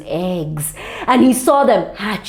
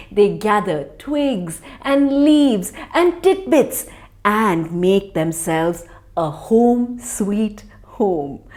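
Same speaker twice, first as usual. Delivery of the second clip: ordinary delivery, nothing marked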